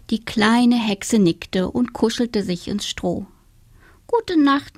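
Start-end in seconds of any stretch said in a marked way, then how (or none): 4.1-4.8 higher little witch saying goodnight; the witch bidding her friends goodnight; sleepy little witch. Acted. (kleine Hexe, gute Nacht)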